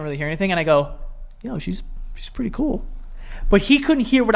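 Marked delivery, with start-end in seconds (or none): 1.4-2.8 hushed, whispery remark; retold quiet aside. Quiet aside in loud club.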